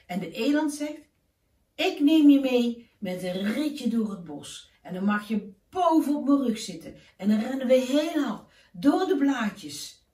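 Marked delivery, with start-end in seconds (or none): 1.8-10.1 bigger, louder moose voice, keen to show the forest; eager moose. Acted. Moose invites Keesje for a forest ride, louder and eager. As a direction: play the moose, inviting and lively.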